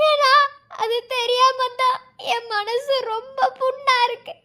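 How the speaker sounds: usual speaking voice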